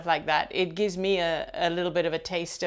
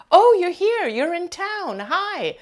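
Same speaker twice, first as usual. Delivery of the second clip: much higher, louder gushing hello, imitating a stranger; imitating an excited greeting; 12 semitones higher, 8 dB louder, slower than usual. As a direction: gush like greeting an old friend. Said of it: "the way she spoke to me was as if she was somebody I knew".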